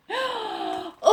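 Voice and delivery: sharp little gasp; a storyteller's mock gasp; surprised gasp.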